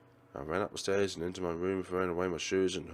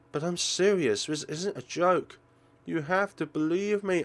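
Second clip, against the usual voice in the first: pleading, louder and higher; earnest insistence; 7 semitones higher, 4 dB louder than usual. Acted. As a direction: make her believe you. Insisting it is no joke.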